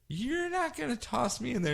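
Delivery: high, soft plea; high pleading voice. Retold or imitated. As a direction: beg not to be thrown in, high and quiet. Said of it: "he goes".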